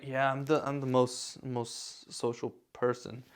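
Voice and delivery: low, quiet, trailing off; unconvincing boast. Shown as a demonstration. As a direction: claim it without believing it. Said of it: "you're talking like that".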